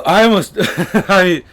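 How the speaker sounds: loud, high laughing burst. Real, not acted. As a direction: laugh through the words. Laughing mid-sentence.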